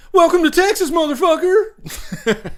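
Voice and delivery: high, loud put-on voice, then slowing into a laugh; imitating the character. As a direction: ham up her taunting movie line. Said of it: "She's like".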